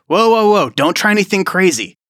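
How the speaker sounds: high, urgent acted warning; alarmed warning. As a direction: play an agent startled by a drawn gun. Agent reacts to drawn gun.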